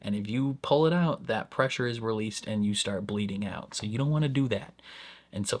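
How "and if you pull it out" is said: ordinary talk, nothing marked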